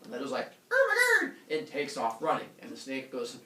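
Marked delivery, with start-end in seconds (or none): 0.7-1.3 high, loud mock-panic shriek; Leto. Girl sees giant snake and runs, high shriek. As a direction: shriek it in hammed-up terror.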